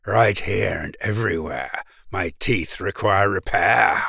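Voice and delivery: low, sly crocodile voice; deep scheming crocodile asking for repairs. A narrator's reading. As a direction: purr it like a cunning crocodile. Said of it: "He said".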